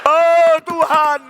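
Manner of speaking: loud, high, slow parody of praying; mock shouted prayer. Preacher imitating table-pounding prayer, loudly wailed. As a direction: drag it out in a big mock yell. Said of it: "doa teriak-teriak sampai pukul-pukul meja".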